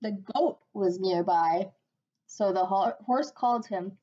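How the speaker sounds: plain reading voice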